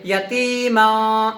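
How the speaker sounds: یتیما very slowly, the ما nasal and hidden; nasal ikhfa. Shown as a demonstration. Teacher reciting the example for students, nasal. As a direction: hide the نون in the nose on یتیما. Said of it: "آواز کو ناک میں چھپا کر کے پڑھیں".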